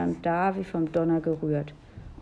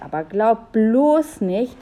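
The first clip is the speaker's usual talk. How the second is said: grudging warning; 4 semitones higher, 6 dB louder, slower than usual. A narrator's reading. Julia grudgingly agrees with warning.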